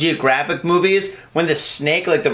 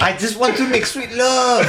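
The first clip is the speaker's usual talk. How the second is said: high, hammed-up French accent; playful fake French romantic; mock French lover; 6 semitones higher than usual. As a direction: croon it in a badly faked French accent.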